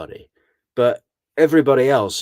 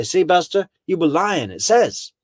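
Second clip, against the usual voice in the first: gloating interrogator; 7 semitones higher, 4 dB louder than usual. Retold or imitated. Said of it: "come back with the results and say".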